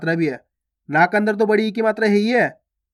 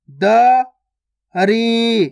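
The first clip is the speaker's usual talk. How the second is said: दरी split as da, pause, ree; syllable by syllable; much slower than usual. Shown as a demonstration. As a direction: slowly sound out दरी with a gap inside. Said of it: "direct मात्रा का उच्चारण करके पढ़ेंगे". (दरी को तोड़कर, द... री)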